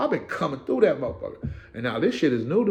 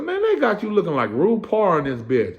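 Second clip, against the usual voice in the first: high, loud mocking tease; teasing homie; 8 semitones higher, 4 dB louder than usual. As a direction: needle him playfully.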